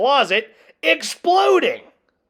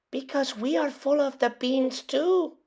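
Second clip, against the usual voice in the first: much higher, chirpy voice listing beans; high little dog voice; 7 semitones higher, 5 dB quieter than usual. A narrator's reading. Poquito lists the beans to Skipito in a high chirpy voice. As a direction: rattle off the beans like a worried pup.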